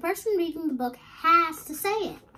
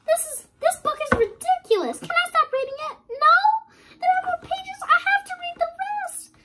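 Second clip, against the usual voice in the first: mock complaint; 14 semitones higher, 6 dB louder than usual. Complaining about the book.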